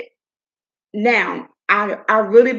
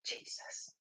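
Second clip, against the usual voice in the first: ordinary talk, nothing marked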